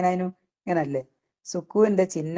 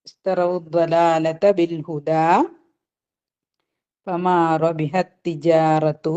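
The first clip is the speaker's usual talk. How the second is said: slow, measured recitation of the verse; slow tajweed reading; Quran recitation; much slower than usual. Shown as a demonstration. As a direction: recite it slowly as in prayer. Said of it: "teacher recites the verse".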